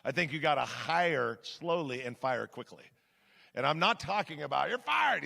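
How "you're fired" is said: raised pitch, mock reality TV boss; mock TV boss firing. Pastor mocking a TV-show firing, raised mock boss voice. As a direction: bark it like a TV show host firing someone. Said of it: "turning into a Donald Trump T V show".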